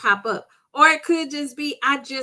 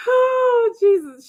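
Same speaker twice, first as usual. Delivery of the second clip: laughing; 10 semitones higher, 4 dB louder, slower than usual. Real, not acted. Cracking up at joke.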